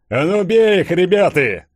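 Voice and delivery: high, loud rallying cry; shouting. A narrator's reading. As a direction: rouse the crowd to fight. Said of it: "закричал Борода". (бородач кричит толпе)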